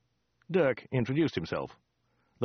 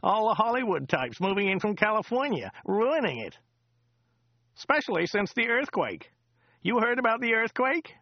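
high, chatty local driver voice; a rambling cab driver, pitched up; chatty driver Joe; 10 semitones higher than usual. Acted. Driver Joe chatting to Dirk on the drive, high chatty voice. Joe's voice again.

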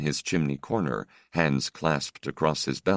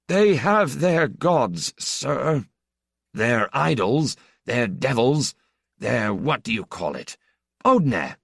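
put-on high, slow, stiffly offended old priest; 9 semitones higher, 3 dB louder, slower than usual. Hurt old priest to the stranger, stiff and high. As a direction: answer with wounded dignity. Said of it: "in a rather stiff tone".

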